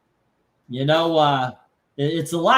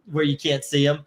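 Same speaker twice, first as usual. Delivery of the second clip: ordinary talk, nothing marked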